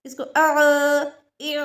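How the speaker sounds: अ ई उ throaty, not drawn out; ain vowels demo. Shown as a demonstration. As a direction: keep the throat sound short and tight. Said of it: "लंबा नहीं करेंगे". (अ ई उ हलक से, लंबा न करके)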